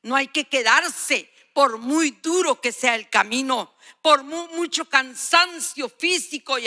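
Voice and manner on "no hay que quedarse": ordinary talk, nothing marked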